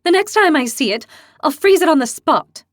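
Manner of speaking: put-on high, loud, boastful girl; fierce young heroine. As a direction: declare it like a hotheaded girl. Young demon Lily, boastful and fierce.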